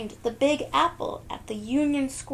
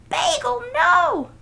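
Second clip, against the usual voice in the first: very high, loud cry; alarmed shout; 10 dB louder than usual. Acted. Nellie shouting at Bagel to stop. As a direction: call the dog back in alarm.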